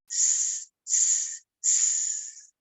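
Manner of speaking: long hissing s sounds; snake hiss. Shown as a demonstration. Storyteller showing kids the snake hiss. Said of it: "can you go".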